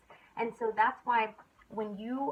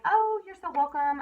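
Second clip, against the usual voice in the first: a higher, louder, delighted reply; gushing customer; 8 semitones higher, 7 dB louder than usual. Retold or imitated. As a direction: play the thrilled customer. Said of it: "and they're like".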